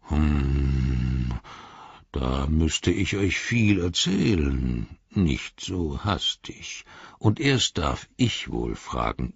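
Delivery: slow, deep voice of a patient tree giant, sometimes whispered; ancient Ent, slow and low. Acted. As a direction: muse over these strange little folk, taking all the time in the world. Treebeard answers slowly. (langsame, tiefe Stimme eines uralten Ents)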